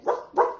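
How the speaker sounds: high breathy ruff; a small puppy yap; dog barking. Acted. Storyteller yaps for the dog to the kids. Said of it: "the dog said".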